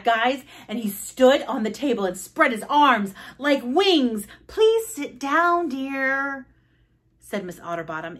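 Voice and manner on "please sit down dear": high, slow, drawn-out request; librarian. Acted. Librarian to Froggy on the table, high slow chiding. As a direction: sing it out slowly as a prim reprimand.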